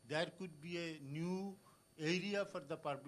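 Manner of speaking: usual speaking voice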